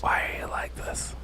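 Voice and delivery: soft, despairing whisper. Real, not acted. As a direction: whisper it in disbelief at a friend. Despairing aside at friend's team choice.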